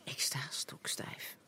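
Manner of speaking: tense breathy whisper; whispering while hiding. A narrator's reading. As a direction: barely breathe the words. Frozen, hiding the touch.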